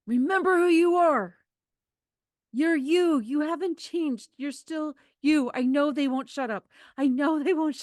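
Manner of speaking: high, loud, desperate plea to a shaken friend; urgent pleading. Acted. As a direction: grab him and beg him to remember himself.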